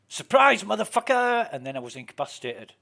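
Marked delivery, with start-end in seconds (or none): 0.1-1.5 high, loud mocking yell; spring the trap with glee; gleeful ambush shout. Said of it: "All I heard was".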